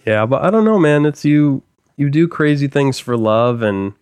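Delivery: usual speaking voice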